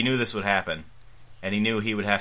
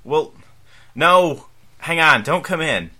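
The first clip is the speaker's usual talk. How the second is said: loud panicked yell; 8 semitones higher, 9 dB louder, slower than usual. Acted. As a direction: shout back, flustered. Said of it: "Sandy yelling from outside".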